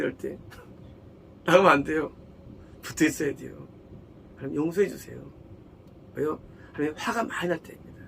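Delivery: normal talking voice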